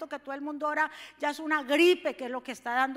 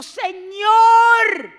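a hint of a Canaanite woman pleading; 5 semitones higher, 14 dB louder, much slower than usual. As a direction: beg like a desperate mother. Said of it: "le dijo".

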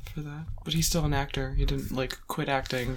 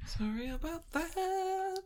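mock apology; 4 dB quieter, slower than usual.

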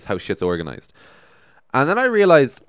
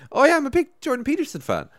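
put-on naive teen fan. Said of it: "I was just like".

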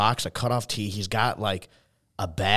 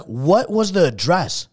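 slow, raised retold demand; exasperated; 5 semitones higher, 4 dB louder, slower than usual. Asking the stranger at the door for the third time, exasperated. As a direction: spell it out with mock-strained patience.

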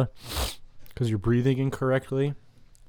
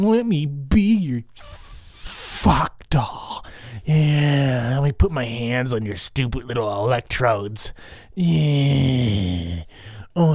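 brainwashed cultist; 2 semitones higher, slower than usual. Pretend. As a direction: swoon like a duped believer. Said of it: "I'd be like".

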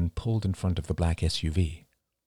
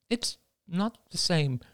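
high, slow, weak objection; hesitant woman's voice, drawn out; without conviction; 12 semitones higher, slower than usual. A narrator's reading. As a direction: protest without believing it. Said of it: "said Cassie without conviction".

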